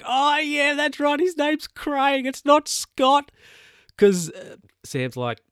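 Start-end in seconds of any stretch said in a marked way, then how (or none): 0.0-3.3 very high, slow mock secretary voice; high-pitched imitation of the secretary. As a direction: squeak it out like a sweet office secretary. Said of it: "And the secretary's like".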